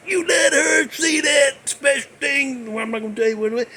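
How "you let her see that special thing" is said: mock angry shout. Angry elf scolds the hero.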